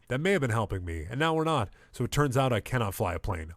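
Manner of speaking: usual speaking voice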